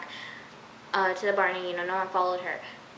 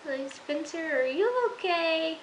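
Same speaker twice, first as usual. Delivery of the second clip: high startled what, then a slow worried question; shocked disbelief; 6 semitones higher, slower than usual. Retold or imitated. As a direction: gasp it out as if a friend just lost it. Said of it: "I was like".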